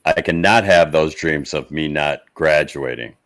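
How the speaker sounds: ordinary talk, nothing marked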